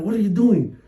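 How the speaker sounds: high-pitched imitation of shouting; mock shout, pitched well up; retold shout. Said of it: "he walked up and shouted".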